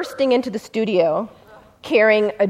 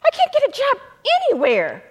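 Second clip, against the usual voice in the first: desperate plea; 12 semitones higher than usual. Retold or imitated. The felon begging his lawyer, pitched high and despairing. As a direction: voice jumps much higher in a despairing plea.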